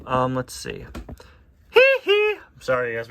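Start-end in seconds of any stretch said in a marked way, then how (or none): 1.7-2.4 very high squealing hee hee. Retold or imitated. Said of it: "bad [name] impression".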